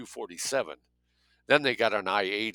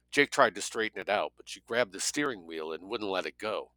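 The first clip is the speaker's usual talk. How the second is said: ordinary delivery, nothing marked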